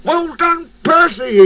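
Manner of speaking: very high cheering voice; excited shout. A narrator's reading. Driver to Percy, high excited shout. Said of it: "he shouted".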